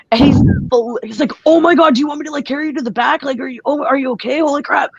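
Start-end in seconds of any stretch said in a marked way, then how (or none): 1.5-4.9 flipping out. Retold or imitated. Ref panicking over a wrestler's broken finger, higher and excited. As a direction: play the ref freaking out over a broken pinky. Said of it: "And he starts flipping out".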